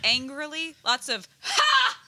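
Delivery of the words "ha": sharp angry "Ha!" guffaw; loud guffaw. Retold or imitated. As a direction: bark out a loud angry laugh. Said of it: "that's my guffawing angrily".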